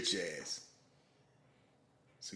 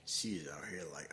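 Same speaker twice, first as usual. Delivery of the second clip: whisper; 5 semitones lower, 7 dB quieter than usual. Real, not acted. Whispered lead-in.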